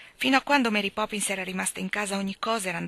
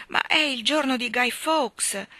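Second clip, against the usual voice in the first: slow, higher coaxing plea; 5 semitones higher, 4 dB louder, slower than usual. A narrator's reading. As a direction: try to tempt him. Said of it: "per allettare il marito". (la moglie lusinga il marito, lenta e dolce)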